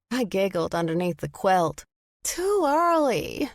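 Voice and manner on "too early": whiny, drawn-out and high; mock complaint. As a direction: whine it like a fake protest. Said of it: "I mock complained".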